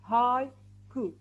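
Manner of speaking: "haiku" broken slowly into hai, pause, ku; syllable by syllable. Shown as a demonstration. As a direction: sound out both parts of "haiku" separately. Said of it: "how many parts do you think".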